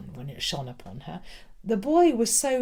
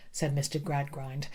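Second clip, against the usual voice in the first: ordinary delivery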